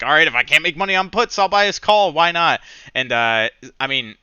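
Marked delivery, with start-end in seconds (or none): 0.0-2.6 loud, fast, fed-up quote; frustrated defiance. Retold or imitated. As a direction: throw up your hands and go for it. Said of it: "I'm like".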